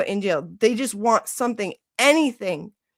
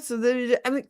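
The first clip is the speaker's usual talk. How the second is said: usual speaking voice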